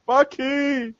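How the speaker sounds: high, drawn-out cry of a name; mock shout. Friend acting out thawed Captain America shouting for Bucky, mock shout. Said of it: "starts thawing out and shouting".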